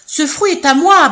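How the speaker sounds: loud, high elephant trumpet; trumpeting claim. Acted. First elephant trumpeting his claim to the fruit, loud. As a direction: blare it out like a greedy elephant. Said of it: "barrit le premier éléphant".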